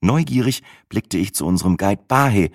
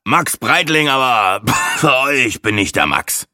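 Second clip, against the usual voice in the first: coughing; 6 semitones higher than usual. A narrator's reading. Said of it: "hustete der Angeber".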